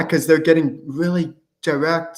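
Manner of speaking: ordinary delivery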